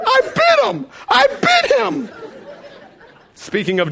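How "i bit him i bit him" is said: much higher, louder, whiny sob-talk; mock crying kid. Son tearfully complaining to dad, high and crying. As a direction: wail like a wronged child. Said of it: "he starts crying".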